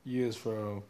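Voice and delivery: loud, high put-on Caribbean lilt with laughs; hammed-up island accent meant as British; mock Jamaican-sounding accent. Host to his co-host in a loud mock accent. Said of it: "you sound Jamaican".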